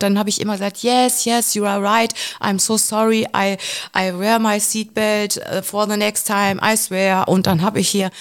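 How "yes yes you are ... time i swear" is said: imitated meek, placating apology; submissive. Frightened passenger placates an armed man, retold submissive apology. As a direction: play the obedient pupil, eager to appease. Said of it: "auch ein Stück weit devot".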